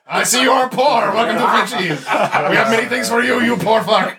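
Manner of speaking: put-on high, laughing Quebec French accent; hammed-up Quebec French shopkeeper. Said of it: "talk with a fake, uh, Quebec accent".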